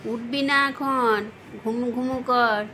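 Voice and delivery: firm slow warning. Real, not acted. Telling other pet to sleep.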